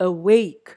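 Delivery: "awake" with a loud WAKE; a-WAKE, light first sound in "awake"; second-syllable stress. Shown as a demonstration. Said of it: "second syllable".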